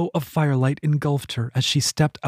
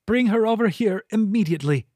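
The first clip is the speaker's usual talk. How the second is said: a raised, authoritative leader's order; commanding; 7 semitones higher than usual. A narrator's reading. Voluptuary striding in and ordering attendants, commanding. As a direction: command the room from the doorway. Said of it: "filling it with command".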